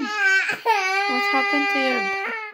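very high, loud, slow cooing with a cry. Real, not acted. Comforting baby dramatically. (napakataas, malakas at mabagal na paglalambing)

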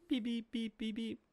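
high imitated beeping; playful robotic beeps; antenna beeping. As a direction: chirp it out like a little signal.

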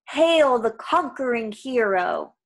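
slow, loud proclamation; booming, drawn-out sinister salute; mocking evil voice. A narrator's reading. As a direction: hail the hero in mockery, drawn out. Evil voice mocks the dreaming hero.

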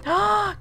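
high sharp gasp of delight. Real, not acted. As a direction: gasp at something cute. Reacting to a new plush.

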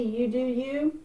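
usual speaking voice

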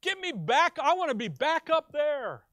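high, loud pleading to the Father; voicing Jesus. Jesus pleading with the Father, loud and high. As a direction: cry out the longing to go home. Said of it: "he says".

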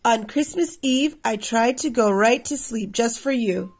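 ordinary delivery, nothing marked

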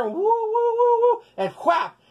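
high swooping woo-woo of a sling being swung, then a whap; playful sling sound for the kids; whirling sling whoosh. Retold or imitated. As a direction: swing the sling round with a high whistling woo.

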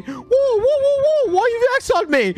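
very high mock wail; hammed-up high-pitched lament; impression contest. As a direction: plead in a squealing high voice. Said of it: "Are you ready for mine?".

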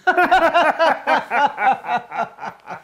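loud, rapid-fire belly laugh; hearty laughter that tapers off; big laugh. Acted. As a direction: burst into a long laugh. Laughing at the teasing exchange.

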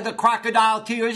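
usual speaking voice

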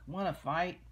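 loud grouchy challenge; ladybug voice. Acted. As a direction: bark it like a grumpy bug.

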